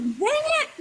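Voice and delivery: high squeaky "dang it"; a high, playful groan of defeat; mock frustration. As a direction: whine it like a sore loser. Mock frustration at being wrong.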